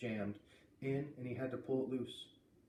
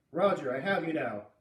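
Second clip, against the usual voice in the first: ordinary delivery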